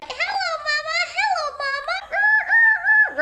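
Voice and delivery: very high-pitched parrot-like squawk; talking bird impression. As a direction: squeak it like a pet bird. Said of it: "you have the birds that are like".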